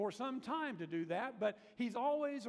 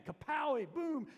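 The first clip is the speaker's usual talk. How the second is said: comic-book kapow; 7 semitones higher, 6 dB louder than usual. Retold or imitated. Comic book sound effect.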